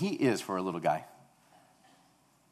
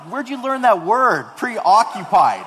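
astonished dad; 10 semitones higher, 13 dB louder, slower than usual. Retold or imitated. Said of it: "I turned around like".

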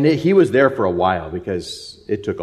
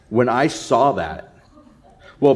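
usual speaking voice

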